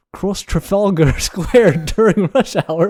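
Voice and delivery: put-on slow British accent breaking into laughter; British accent, amused. Podcaster cracks up reading the teleprompter, laughing British accent. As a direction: struggle through it in British, laughing. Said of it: "read in a British accent".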